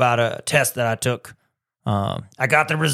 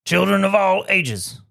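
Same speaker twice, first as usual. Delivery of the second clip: ringmaster; 4 semitones higher than usual. Acted. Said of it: "Boys and girls, ladies and gentlemen".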